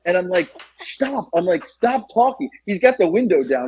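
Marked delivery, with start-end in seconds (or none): 0.8-1.3 high, softened mock plea; acted paranoid shushing; urgent hushing. Stoned teller hushing her friend. 1.7-2.5 high-pitched mock panic; acted paranoid pleading; urgent hushing. Stoned teller hushing her friend.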